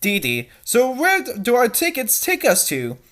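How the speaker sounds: usual speaking voice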